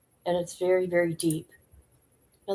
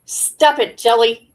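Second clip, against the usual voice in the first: loud, louder with each stop it; shocked outcry; 2 semitones higher, 7 dB louder, slower than usual. Real, not acted. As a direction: cry out in disbelief at the clip. Reacting to Jelly's shocking story.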